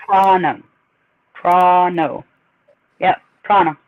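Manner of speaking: "Toronto" shortened to "Tronno"; local Canadian pronunciation. Shown as a demonstration. Confirming the Canadian way, "Toronto" as "Tronno". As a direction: swallow the second t of "Toronto". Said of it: "It's pronounced".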